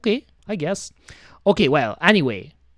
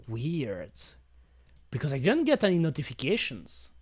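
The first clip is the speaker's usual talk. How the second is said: normal talking voice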